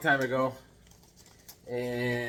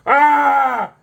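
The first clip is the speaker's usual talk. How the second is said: high mock yell of a raving man; imitated yell; 11 semitones higher, 13 dB louder than usual. Retelling the man yelling in church, a mock yell. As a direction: belt out a wild shout in imitation. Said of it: "he's in the back going".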